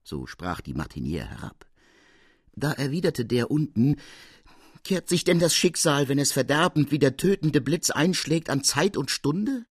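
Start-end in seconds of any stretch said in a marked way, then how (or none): 4.8-9.7 a hint of a pleading young man, pitched up; mild dramatic plea; lightly voiced stranger. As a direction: ask it with solemn urgency. Stranger's reply from below.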